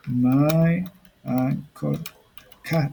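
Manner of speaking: very drawn-out words with gaps; slow word-by-word dictation. Shown as a demonstration. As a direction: stretch each word as if typing it. Writing out the example word by word.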